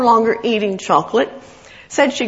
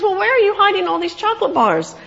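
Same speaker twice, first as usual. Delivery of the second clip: pitched way up in amazed disbelief; surprised husband; 11 semitones higher than usual. Retold or imitated. Husband discovering her hidden chocolate, high surprised voice. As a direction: gasp it out, caught off guard. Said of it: "He goes".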